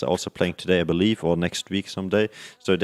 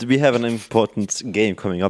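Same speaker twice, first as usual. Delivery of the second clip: normal talking voice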